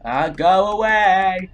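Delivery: ordinary delivery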